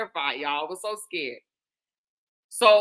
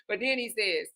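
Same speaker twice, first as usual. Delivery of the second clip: usual speaking voice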